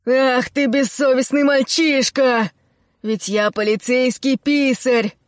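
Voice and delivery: put-on indignant shout at the boy; loud shout. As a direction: yell it out in outrage. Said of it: "громко крикнул".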